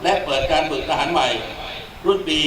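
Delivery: ordinary talk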